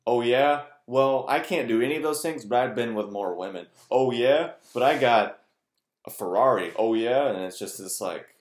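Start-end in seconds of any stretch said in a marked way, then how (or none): 0.0-3.7 loud, cocky one-upping brag; mock boast. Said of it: "I'm making fun of that". 3.9-6.7 loud, cocky one-upping brag; mock boast. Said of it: "I'm making fun of that". 6.8-7.3 mock challenge. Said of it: "I'm making fun of that".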